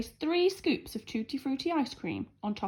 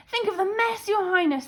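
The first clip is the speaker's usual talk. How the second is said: guard voice; 9 semitones higher, 5 dB louder than usual. A narrator's reading. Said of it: "said the guard".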